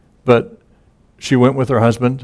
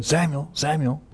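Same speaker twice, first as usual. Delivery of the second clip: higher but softer call of the name; 5 semitones higher, 6 dB quieter than usual. Retold or imitated. God calling Samuel a third time, soft and higher. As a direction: call the name gently, almost hushed.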